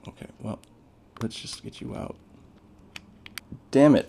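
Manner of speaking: ordinary talk, nothing marked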